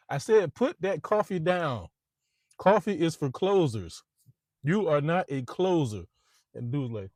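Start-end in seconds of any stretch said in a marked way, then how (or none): 0.1-6.0 louder, higher, slow and scolding; angry boss. Retold or imitated. Boss scolding a salesman over coffee, angry and slow. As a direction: lay into him word by word. Baldwin berating salesmen, retold.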